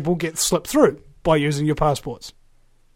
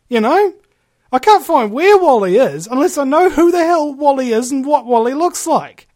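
higher, louder, laughing bewilderment; mock exasperated search; 7 semitones higher, 5 dB louder than usual. Someone hunting for Wally without knowing his face, loud and laughing. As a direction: play it like you're lost in the picture book.

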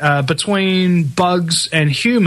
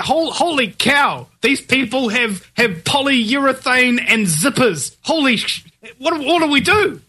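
higher-pitched, hammed-up alarm; mock panic; 6 semitones higher than usual. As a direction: play the scared elite, aghast at a trifle. Mocks elite panicking about protesters.

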